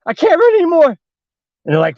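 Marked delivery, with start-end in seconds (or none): 0.0-0.9 high, strained acted wail; winded panic. Winded friends fleeing down the stairs, high panicked wail. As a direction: cry out as if you can go no further. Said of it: "some of them ran out of breath".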